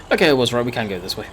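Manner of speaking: ordinary talk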